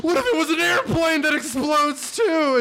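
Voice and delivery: high, over-excited imitation; hyped-up mock pitch. Imitating filmmakers high on cocaine, mock excitement. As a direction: pitch it like a wildly overexcited idea. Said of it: "And they were like".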